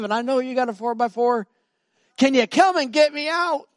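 put-on high, loud, urgent teen voice; frantic pleading, pitched well up; pleading youth. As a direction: act out a desperate kid begging for rescue. Youth pleads for rescue, retold.

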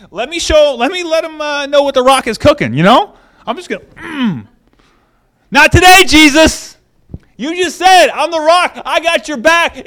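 loud, high, pumped-up bravado; fired-up Peter. Retold or imitated. Peter vowing to protect Jesus, loud and fired up. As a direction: puff up like a hothead ready to fight. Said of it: "Peter's all fired up".

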